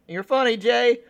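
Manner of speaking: high, loud sycophantic praise; cheesy band sidekick. Retold or imitated. Mocking a talk show band flattering the host, high and loud. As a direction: fawn over the host. House band's cheesy line.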